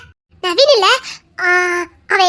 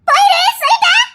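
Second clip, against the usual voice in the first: high, louder cry to go away; frightened shout; 9 semitones higher, 4 dB louder than usual. Acted. Cornered girl shouting at the man to leave. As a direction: yell at him to leave, terrified.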